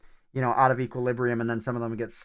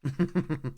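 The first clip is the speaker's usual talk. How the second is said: a brief run of quick chuckles; short chuckle; 3 semitones higher than usual. Real, not acted. Laughs at own mistake in game.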